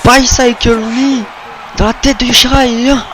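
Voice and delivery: high, loud near-fall call; excited count. Real, not acted. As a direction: count the pin at full voice.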